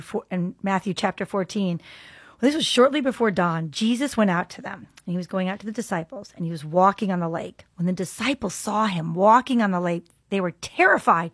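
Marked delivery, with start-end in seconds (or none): none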